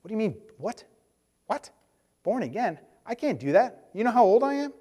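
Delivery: bewildered, pitch climbing to indignation; baffled disbelief. Retold or imitated. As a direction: play the old man who can't follow. Nicodemus baffled by born again.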